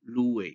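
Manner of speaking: LOUER said in French with a W glide between the vowels; glide pronunciation. Shown as a demonstration. Lecturer showing the inserted glide after a round back vowel, glide pronunciation. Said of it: "And we pronounce that".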